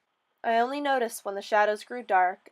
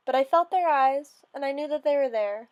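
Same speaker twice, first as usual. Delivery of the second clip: plain reading voice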